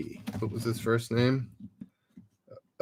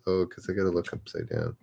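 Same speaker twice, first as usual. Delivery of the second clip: ordinary delivery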